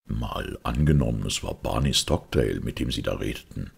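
low, composed detective; Holmes. Acted. Detective suggests Barney Stockdale's name, low and composed. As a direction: probe calmly in a deep voice.

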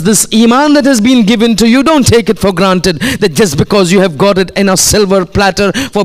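high, forceful sermon delivery; impassioned preaching. Real, not acted. Preacher warning congregation not to take faith for granted, impassioned.

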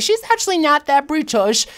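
a lightly put-on British accent; mock British accent imitating British viewers; bad British accent. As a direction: try a British accent and miss a bit. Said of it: "I wish I had a better British accent".

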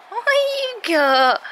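excited dog talk. Real, not acted. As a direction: ham it up for the dog. Teasing dog, second bigger take.

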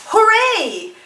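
high bright cheer; excited cheer; cheering hooray. Shown as a demonstration. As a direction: celebrate like a fan. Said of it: "excitement and pleasure".

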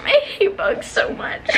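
high, slow, breathy sob; wailing through tears; crying. Real, not acted. She cries to viewers about hating bugs.

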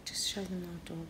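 soft whisper, barely voiced. Real, not acted. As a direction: whisper it so as not to disturb. Whispering while showing the dog.

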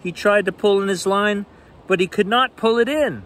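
lively bedtime read-aloud; animated picture book reading; story narration. A narrator's reading.

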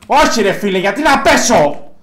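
loud, high, drawn-out protest; frustrated shout. Real, not acted. Gamer yelling at the game after going down. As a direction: cry out in disbelief at dying.

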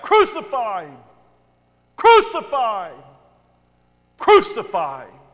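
loud, hard crowd yell; forceful repeated cry; mob shout. A narrator's reading. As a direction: hurl it out like a baying mob. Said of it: "they shouted back".

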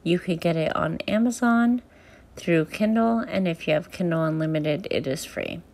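plain reading voice

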